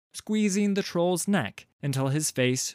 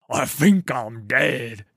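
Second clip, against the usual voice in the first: dazed troll; 7 dB louder, slower than usual. Acted. Dazed troll after skull smashed.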